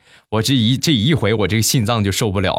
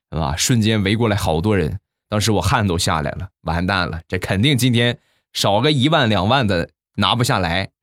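normal talking voice

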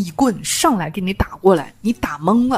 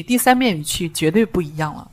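ordinary talk, nothing marked

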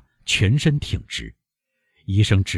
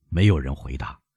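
plain reading voice

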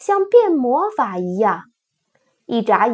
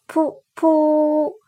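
horn toot sound; 4 semitones higher than usual. Acted. Imitating a paper horn.

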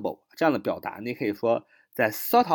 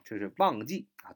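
normal talking voice

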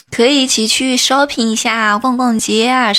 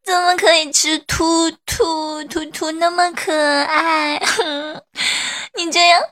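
theatrical sobbing, pitched higher; hammed-up crying; 5 semitones higher than usual. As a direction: wail childishly through exaggerated sobs. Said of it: "不哭了，不哭了".

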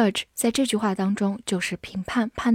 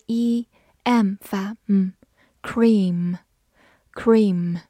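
slow word demo; much slower than usual. Shown as a demonstration. Teacher modelling cream slowly for students. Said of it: "E A字母组合发长音".

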